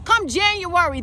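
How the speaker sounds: normal talking voice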